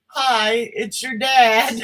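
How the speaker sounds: slow mock dad voicemail; drawn-out dad greeting; slow dad-on-voicemail impression; imitating dad. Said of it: "my dad calls me and he says".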